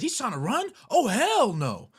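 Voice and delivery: loud, high-pitched indignant outburst; outraged mom. Retold or imitated. Mom jumps out as driver flees, loud outrage. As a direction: imitate a furious mother, drawing out the last words.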